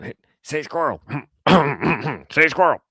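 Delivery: high, urgent mock mutter between fake coughs; cough-disguised heckle. Imitating buddy pestering the teacher, high cough-disguised heckle. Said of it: "under his breath".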